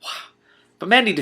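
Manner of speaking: plain reading voice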